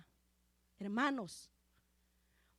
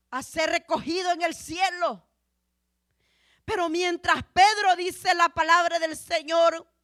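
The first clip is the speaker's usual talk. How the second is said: projected, forceful sermon voice; preaching; 4 semitones higher, 12 dB louder than usual. Real, not acted. Preacher tells brethren how tremendous the vision was, forceful preaching. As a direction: drive the message out to the whole congregation.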